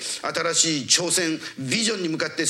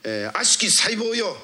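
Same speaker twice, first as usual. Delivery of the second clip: raised-pitch preacher's command; commanding healing prayer; 5 semitones higher than usual. Real, not acted. Preacher ordering sickness to vanish, forceful command. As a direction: order it away with rising force.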